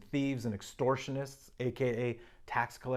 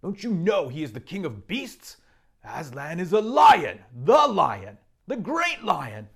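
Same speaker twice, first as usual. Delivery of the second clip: stern Mister Beaver; 5 semitones higher, 8 dB louder than usual. Acted. Mister Beaver sternly telling the children who Aslan is, loud and proud. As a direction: boom out the lion's greatness. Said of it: "said Mister Beaver sternly".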